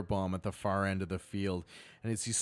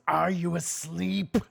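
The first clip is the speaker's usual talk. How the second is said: loud, slow, raised-pitch shout; scolding goblin boss; 8 semitones higher, 6 dB louder, slower than usual. Acted. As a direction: yell it at a dozing underling.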